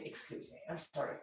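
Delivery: a discreet murmured apology after a sneeze; hushed apology. Shown as a demonstration. To people nearby after a public sneeze, whispered apology.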